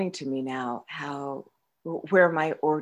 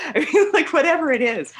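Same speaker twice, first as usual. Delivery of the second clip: quick laughing words, pitched up; fast amused giggle; 12 semitones higher, 6 dB louder, faster than usual. Real, not acted. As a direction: laugh it off and rush on. Laughing at past excuses.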